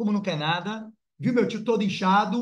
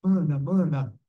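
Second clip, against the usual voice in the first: imitating the dead uncle; 4 semitones lower, slower than usual. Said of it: "tô fazendo a de meu tio". (imitando a voz do tio, mais grave)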